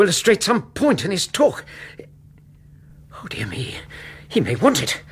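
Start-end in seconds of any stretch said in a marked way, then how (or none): 3.1-3.8 soft whispered fret; whispered dismay. Acted. Doctor frets quietly over the strange toy. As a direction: sigh it under the breath.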